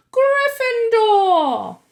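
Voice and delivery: long, very slow, loud high shout; hat shouting. A narrator's reading. The hat announcing Harry's house, drawn-out shout. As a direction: proclaim it to the whole hall. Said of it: "heard the hat shout the last word".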